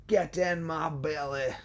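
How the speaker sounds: high, slow, drawn-out character voice; movie catchphrase voice. Acted. Hungry character wanting food in belly, slow comic voice. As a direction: stretch each word out in a put-on voice.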